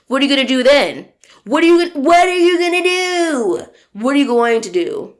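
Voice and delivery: mock confrontational grilling; heated challenge. Pressing her collaborator in person, loud and heated.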